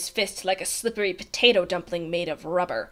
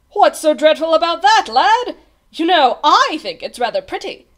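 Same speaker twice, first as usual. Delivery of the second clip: loud, raised, jovial delivery; amused dismissal; 6 semitones higher, 8 dB louder than usual. A narrator's reading. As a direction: scoff lightly at the worry. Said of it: "laughed the captain".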